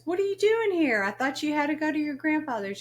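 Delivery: high, quick surprised question; a boss caught off guard; surprised manager. Retold or imitated. Manager surprised the lying employee showed up at work.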